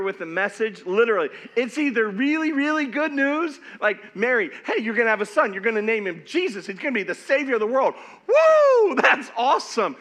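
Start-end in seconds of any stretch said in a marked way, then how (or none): 4.2-7.9 loud joyful news, rushing the end; bursting with good news; excited announcement. Retold or imitated. 8.3-9.0 loud high cheer; excited whoop. Real, not acted. Cheering the news.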